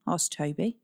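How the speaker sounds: plain reading voice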